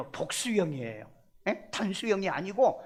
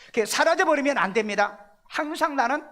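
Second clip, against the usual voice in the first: fervent preaching; 7 semitones higher, 5 dB louder than usual. Real, not acted. Preacher warning the congregation.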